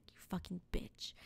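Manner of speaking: whispered under the breath; muttered insult. Retold or imitated. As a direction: hiss it quietly at the cat. Muttered insult at hissing cat.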